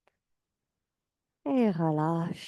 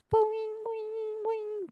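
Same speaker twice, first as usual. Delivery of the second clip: boing sound; 4 dB quieter, slower than usual. Shown as a demonstration. Bouncing heels on imagined trampolines.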